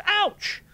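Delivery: sharp high loud ouch; yelp of pain. A narrator's reading. Morris yelps when Fulvia bites his ear. Earlobe bitten.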